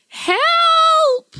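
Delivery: very high, loud scream; scream for help. Acted. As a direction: shriek it out in terror. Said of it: "stood rooted to the spot and screamed".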